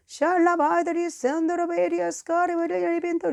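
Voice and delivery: high, rapid-sounding stream of syllables; speaking in tongues. Shown as a demonstration. Preacher showing a fellow believer how, speaking in tongues. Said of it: "자, 보세요".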